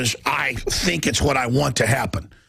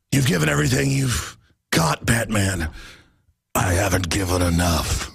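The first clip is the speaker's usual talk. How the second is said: movie-hero growl, dropping much lower; Batman; 5 semitones lower than usual. Acted. Host mocking film actors' Batman lines, hoarse low impression. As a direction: do a hammed-up, low superhero actor voice. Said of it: "those actors trying to talk like that".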